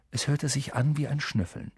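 ordinary delivery

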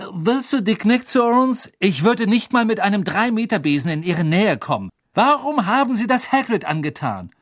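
high, heated indignation; angry confrontation. A narrator's reading. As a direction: throw the accusation at her. Harry angrily confronts the reporter.

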